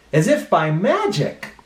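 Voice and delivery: put-on lively storybook voice for kids; reading to children. A narrator's reading. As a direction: read it like a children's librarian.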